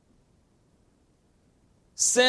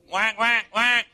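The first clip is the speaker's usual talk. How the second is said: quick, higher-pitched mock quacks; duck sounds; 4 semitones higher, slower than usual. Preacher teasing his listeners as ducks, mock quacking. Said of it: "I hear duck, duck sounds".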